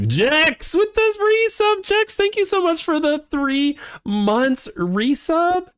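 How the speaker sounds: very high-pitched, drawn-out announcer-style cheer; hyped resub shout-out. Real, not acted. Streamer thanks viewer for three months resub, hyped. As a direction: stretch it out slowly, way up high, like a hype call.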